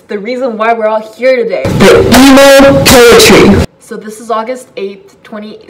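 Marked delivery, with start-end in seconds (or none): 0.0-3.7 loud mock fanfare; hype announcement. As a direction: announce it like a big reveal. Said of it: "building hype announcement".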